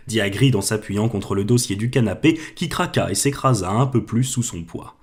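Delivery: usual speaking voice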